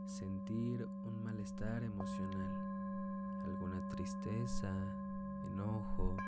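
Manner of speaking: calm, unhurried meditation guide; guided meditation. Acted. Leads a meditation. (voz de meditación guiada)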